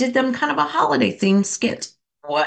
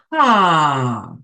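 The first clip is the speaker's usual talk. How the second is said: big audible sigh, louder; 4 semitones lower than usual. Shown as a demonstration. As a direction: release it all in one long loud sigh. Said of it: "Now sigh it out".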